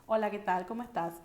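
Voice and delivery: "Hola, ¿qué tal? ¿Cómo estás?" on a level tune; flat Paraguayan intonation. Shown as a demonstration. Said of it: "So we speak like this".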